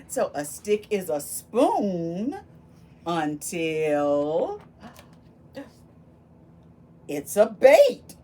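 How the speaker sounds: slow build, then a loud bait; dramatic reveal. Acted. Reading the refrain to the children, slow build and loud reveal. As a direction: stretch until, then pop the last word.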